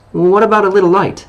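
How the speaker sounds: high, apologetic little plea; a hint of apology, voice lifted. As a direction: ask sheepishly, almost sorry to ask.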